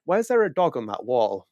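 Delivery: high, puzzled questioning voice; puzzled Netflix exec. Pretend. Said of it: "Netflix is exactly gonna be like".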